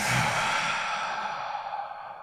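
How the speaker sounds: long unvoiced sigh out after a double inhale; demo double sigh. Shown as a demonstration. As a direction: sigh it all out slowly, audibly. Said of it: "So it sounds a little like this".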